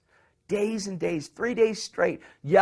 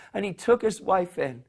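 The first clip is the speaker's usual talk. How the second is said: ordinary talk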